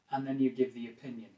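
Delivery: normal talking voice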